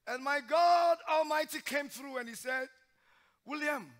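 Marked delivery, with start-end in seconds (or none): none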